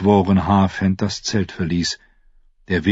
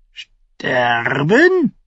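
higher croaked raven word; muttering raven; 7 semitones higher than usual. A narrator's reading. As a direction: croak it like a crow. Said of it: "murmelte der Rabe".

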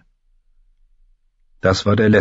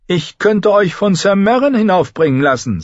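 high, loud cruel king; cruel boy-king; 11 semitones higher, 3 dB louder than usual. A narrator's reading. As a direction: threaten her with a child's glee. Joffrey threatens Sansa. (hoher, lauter grausamer Knabenkönig)

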